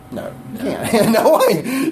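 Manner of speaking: laughing through the answer. Real, not acted. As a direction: chuckle out the no, amused. Laughs at own question to congregation.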